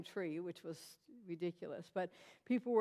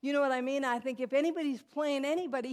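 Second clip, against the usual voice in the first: ordinary delivery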